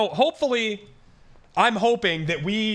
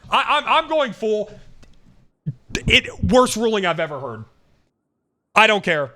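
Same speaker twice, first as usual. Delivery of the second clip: outraged ranting; 7 semitones higher, 4 dB louder than usual. Real, not acted. Streamer raging at unfair ruling.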